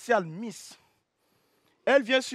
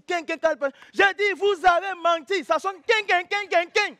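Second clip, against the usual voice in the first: ringing sound imitation; 6 semitones higher than usual. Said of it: "Ça sonnait quin".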